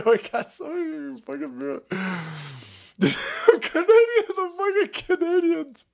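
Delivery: high, breaking words through laughter; helplessly cracking up; real laughing fit. Real, not acted. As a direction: laugh so hard the words stretch. Laughs about Canadian viewers.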